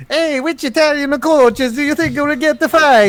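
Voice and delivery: very high cartoon Mario Italian accent. Trying the traditional Mario take on the tweet, very high. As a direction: squeak it out like the Mario plumber. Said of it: "like the Mario".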